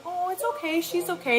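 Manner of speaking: a hint of gentle comforting; imitated reassurance. Husband comforting the crying speaker.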